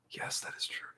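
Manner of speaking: barely audible whisper; hushed confession. Real, not acted. Streamer admitting the rumor to himself, whispered. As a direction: whisper it under the breath. Repeats the confirmation under his breath.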